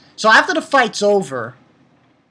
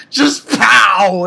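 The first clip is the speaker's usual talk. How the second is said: shouted pow; 2 semitones higher, 7 dB louder than usual. Retold or imitated. Sound of the punch.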